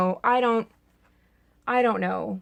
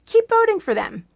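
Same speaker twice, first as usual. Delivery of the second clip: higher mock cheer on voting; sarcastic cheer pitched higher; 8 semitones higher than usual. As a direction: sell "Keep voting for them" with a bright fake encouragement. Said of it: "that was called sarcasm".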